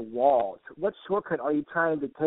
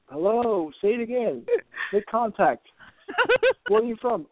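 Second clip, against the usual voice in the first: high-pitched mock hailing; calling out; 8 semitones higher, 3 dB louder than usual. Passer-by hailing a ghost in the wall, mock calling out. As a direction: call out to an unseen spirit, pitched up.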